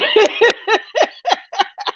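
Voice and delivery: a long high burst of laughter. Real, not acted. Host asks if the viewer is a troll and cracks up, high laughter.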